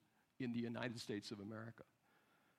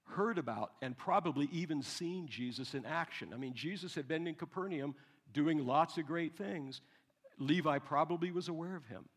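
ordinary talk, nothing marked

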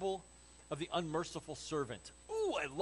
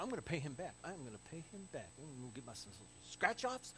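soft, trailing mock mutter; muttering to himself; 3 semitones lower, 8 dB quieter than usual. Forgiven debtor walking out, muttering to himself. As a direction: mumble a resolve under the breath.

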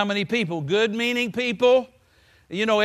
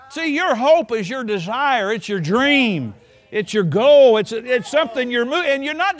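emphatic preaching; 5 semitones higher, 7 dB louder than usual. Acted. Preacher urging listeners on, louder and louder. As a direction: hammer each word louder.

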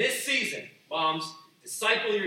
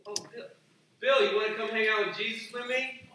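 raised, louder friendly call to Bill; inviting a friend; 6 semitones higher, 6 dB louder than usual. Pretend. Friend calling to Bill to come pray with him, lightly raised voice. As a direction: coax Bill along, brighter and louder.

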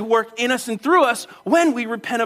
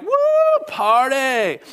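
high whooping party cheer; angels cheering; 4 semitones higher, much slower than usual. Retold or imitated. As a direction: whoop like a crowd of celebrating angels. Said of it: "the angels are like".

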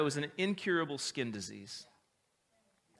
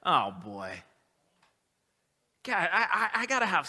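mock dismayed Jesus. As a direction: sigh it like a man facing a hopeless job. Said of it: "Jesus wouldn't have been like".

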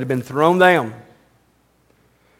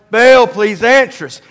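calling out; 5 semitones higher, 5 dB louder than usual. Retold or imitated. Prophets calling out to Baal.

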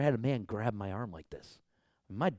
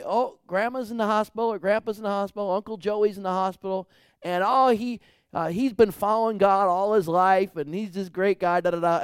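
mock family voice; 10 semitones higher, 11 dB louder than usual. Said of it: "I've been called other times".

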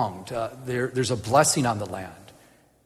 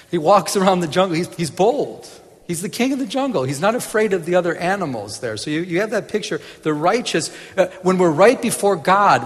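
higher, louder preaching build; preaching at full force; 6 semitones higher, 5 dB louder than usual. Acted. Preacher rousing listeners about bold lions, full preaching voice. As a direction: lift the congregation with it.

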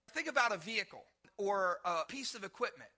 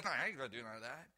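lightly voiced stubborn refusal, much lower and fast; stubborn churchgoer; 11 semitones lower, 5 dB quieter, faster than usual. Pastor imitating a reluctant believer, a hint of stubbornness. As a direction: toss off a mock stubborn refusal.

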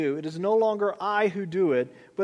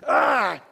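short, louder burst of exasperation; frustrated growl; 5 dB louder than usual. Retold or imitated. Father imitates his son failing at something, mock frustrated cry. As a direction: imitate a frustrated child's grunt. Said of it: "he gets so frustrated when he can't".

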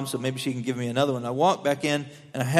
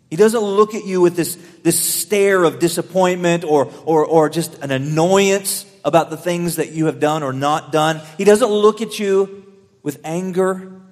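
ordinary talk

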